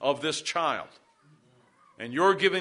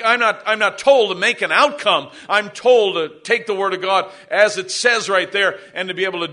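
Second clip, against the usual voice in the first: emphatic, raised pulpit voice; forceful preaching; 4 semitones higher, 8 dB louder than usual. Real, not acted. Pastor charging parents to train their children, forceful preaching. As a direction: press the duty home with punched key words.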